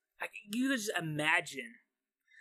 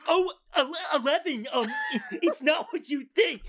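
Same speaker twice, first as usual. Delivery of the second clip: caught out, flustered; 8 semitones higher, 5 dB louder than usual. Acted. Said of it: "he's like".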